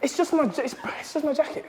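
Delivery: puffed, restarting the sentence between breaths; breathing heavy. Real, not acted. Trainer answering a teasing question about his sweatsuit, breathing heavy. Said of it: "Why are you breathing so heavy?".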